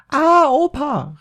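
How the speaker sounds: hoher, langgezogener Schreckensschrei; erschrockener Aufschrei. A narrator's reading. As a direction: schrei vor Ekel und Schreck auf. (Maria schreit entsetzt auf)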